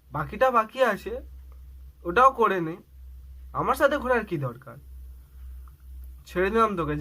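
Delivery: loud, bitter sarcasm; heated telling-off over the phone; jealous anger. Acted. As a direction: throw the breakup at them. Jealous boyfriend breaking up.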